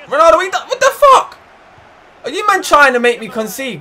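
high, loud angry yell at Ronaldo; frustrated outburst. Real, not acted. Gamer raging at Ronaldo for losing the ball, angry yell. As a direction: shout at the player in disbelief.

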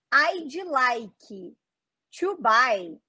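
I'd like said "aidji laiki" with extra vowels; wrong Brazilian pronunciation. Shown as a demonstration. Teacher showing students a wrong accent, Brazilian syllable-by-syllable. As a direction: add a Brazilian "dji" and "ki" to I'd like. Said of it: "fica muito ruim da pessoa te entender".

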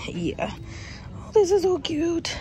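ordinary talk, nothing marked

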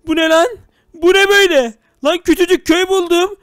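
high, loud astonished shout; surprised exclaim. Real, not acted. Gamer finds a tiny village, shouting in surprise. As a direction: gasp out in amazement.

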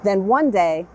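gentle storybook read-aloud with long pauses; unhurried children's story narration. A narrator's reading. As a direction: read it like a bedtime picture book. Reads picture book aloud.

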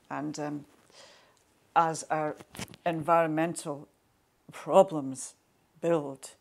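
usual speaking voice

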